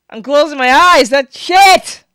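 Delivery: loud, high recoiling protest; horrified outcry. Real, not acted. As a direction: cry out while recoiling from gore. Recoiling from a gory clip.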